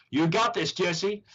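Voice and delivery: cheering her on in a put-on American accent; imitated accent. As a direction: mimic her American accent, building in pitch. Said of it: "she said that, uh, with an accent".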